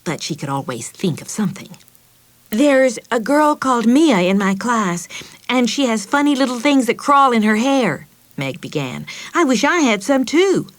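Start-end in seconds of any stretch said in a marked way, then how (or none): 2.5-8.1 put-on lightly raised, chatty young girl; little girl voice. Meg tells the family at dinner, child voice. 9.3-10.7 put-on higher child voice, wishing aloud; wistful little girl. Meg tells her mother she wants some, girlish wish.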